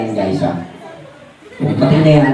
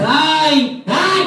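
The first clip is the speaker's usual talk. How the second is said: high, called-out greeting rising at the end; loud hi; 8 semitones higher than usual. Pretend. Puppet Ayo calls hi to the kids, pitched up high. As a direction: call it out to the whole crowd. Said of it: "sabihin mo malakas".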